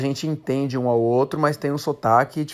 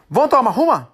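put-on quick, slurred northeastern Brazilian accent, pitched higher; Ceará accent; 8 semitones higher, faster than usual. Friend from Ceará offering a drink, thick Cearense accent. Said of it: "ele falou pro brasileiro".

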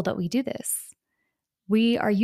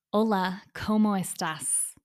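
Spanish with a heavy English accent, slower; strong English accent. Shown as a demonstration. Learner showing how a marked accent sounds in Spanish, strong English accent. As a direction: say the Spanish with thick English vowels. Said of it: "con un acento muy fuerte y marcado".

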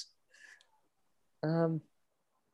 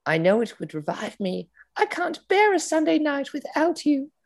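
high, airy put-on posh lady voice; breathless high society lover; Camilla voice; 14 semitones higher than usual. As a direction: sigh it out like a swooning aristocrat. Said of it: "here is the leaked phone call".

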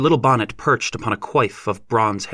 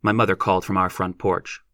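usual speaking voice

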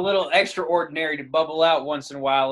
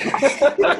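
sudden high burst of laughter; 11 semitones higher, 8 dB louder than usual. Real, not acted. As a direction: laugh out loud at the joke. Laughs at the age joke.

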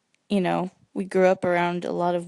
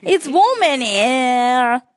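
slow, louder mock Australian "warm in here"; Australian accent; 3 semitones higher, 6 dB louder, much slower than usual. Brother demoing Australian for his sister, slow Aussie accent. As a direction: stretch it like an Aussie. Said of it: "you do an Australian".

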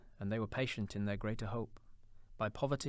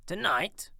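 much higher, loud startled echo; alarmed cry; 9 semitones higher, 8 dB louder than usual. A narrator's reading. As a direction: Scrooge shocked by the news. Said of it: "cried Scrooge".

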